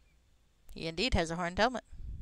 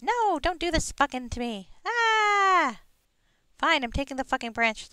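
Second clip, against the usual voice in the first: high squeaky fairy complaining; pixie voice; 10 semitones higher, 7 dB louder, slower than usual. Pretend. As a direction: grumble like an annoyed pixie. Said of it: "my pixie voice".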